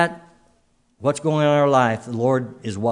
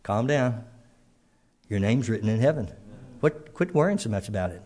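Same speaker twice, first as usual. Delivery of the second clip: softer, gentle reassurance; calm, soothing Lord; 2 semitones lower, 7 dB quieter than usual. Pretend. Preacher voicing the Lord calming a troubled listener, softly reassuring. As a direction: soothe the anxious soul quietly. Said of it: "the Lord comes along and says".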